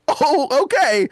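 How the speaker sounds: high-pitched, mildly alarmed reaction; retold freaked-out response. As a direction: act out being rattled, pitch jumping up. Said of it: "So I'm so freaked out".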